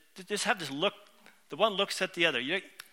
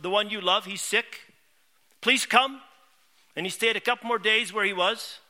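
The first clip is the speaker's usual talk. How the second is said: raised pulpit storytelling; preaching retelling; 4 semitones higher, 6 dB louder than usual. Acted. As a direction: tell it from the pulpit with rising fervour. Preacher retelling Gospel with fervour.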